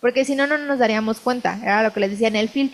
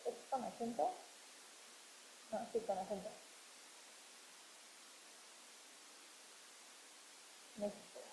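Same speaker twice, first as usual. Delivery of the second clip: muttering to herself; 12 dB quieter than usual. Real, not acted. Muttering to herself while editing.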